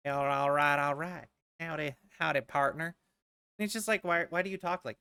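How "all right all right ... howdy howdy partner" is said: drawling mock cowboy greeting; fake cowboy accent. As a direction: put on a laid-back cowboy twang. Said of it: "cowboy accent and they'll".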